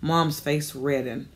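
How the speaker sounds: plain reading voice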